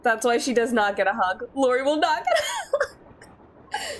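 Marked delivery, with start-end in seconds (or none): 1.5-4.0 laughing through the words; giggling while saying it. Real, not acted. Laughing at own joke.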